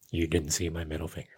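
a playful muttered threat; mock threat aside. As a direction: drop the voice for a cheeky jab. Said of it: "No, I'm just kidding".

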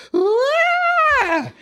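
long, high-pitched mock wail; hammed-up high "waaah"; imitated wail. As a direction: let out her drawn-out cry in imitation. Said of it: "I just hear her go".

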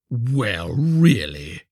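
drawn-out huffy exclamation; affronted miller. A narrator's reading. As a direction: lean on it in offence. Miller takes offence at refusal.

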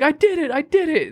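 put-on high excited child voice; proud kid. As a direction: cheer it like a small boy. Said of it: "And he's like".

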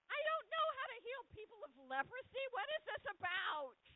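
high, loud panicky king; a frantic king at a loss; shrill panic, hands thrown up; freaked-out king. Retold or imitated. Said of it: "the king of Israel gets freaked out".

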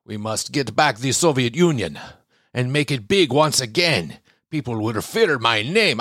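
badly faked Russian strongman accent; mock Russian accent. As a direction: play a hammy Russian leader, thick fake accent. Mock Putin quote, Russian accent.

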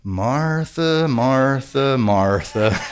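low, gently reproving name-calling; patient Jesus. Acted. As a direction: repeat the name softly, sinking in pitch. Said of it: "is like us saying".

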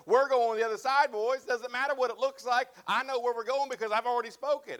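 loud, high, commanding declaration; confident Jesus. Acted. Jesus assuring his disciples mid-storm, loud and commanding. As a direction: boom it out with total authority.

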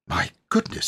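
high breathy exclamation; shocked gasp. Acted. Vicar startled at voices in the tower. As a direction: gasp it in a hush.

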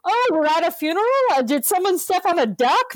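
high, quick mock question; put-on incredulous interruption; mock joking relative. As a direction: act out the relative butting in with a silly gag. Mimics family breaking the mood.

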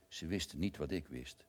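ordinary delivery

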